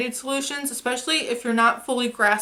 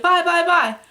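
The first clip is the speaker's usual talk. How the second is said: mocking pushy influencer; 7 semitones higher, 5 dB louder than usual.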